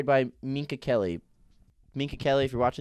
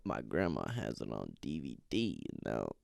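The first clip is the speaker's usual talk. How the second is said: low Fez voice; 12 dB quieter than usual. Retold or imitated. Friend teases Lexi, in a low lazy voice. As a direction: drop way down into a mumbling Fez. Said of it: "he's like".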